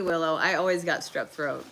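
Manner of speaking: ordinary delivery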